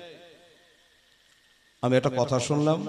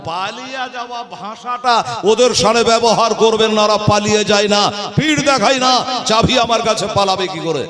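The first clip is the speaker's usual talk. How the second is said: higher, forceful sermon voice; fiery preaching; 8 semitones higher than usual. Real, not acted. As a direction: preach it with fire. (জোরালো ওয়াজের সুরে)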